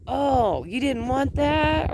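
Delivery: high-pitched, playful guess at his meaning; answering grandson's babble. Retold or imitated. Grandmother guessing what her grandson is saying, high mock-questioning reply. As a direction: raise the voice as if replying to a babbling child. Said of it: "I'll throw out, like, a sentence".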